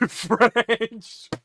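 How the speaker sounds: loud menacing French devil. Pretend. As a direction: boom it out like a villain. Trying out character voices.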